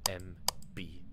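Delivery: hushed dramatic whisper. Pretend. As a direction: whisper the name ominously. Dramatic reveal of the signer.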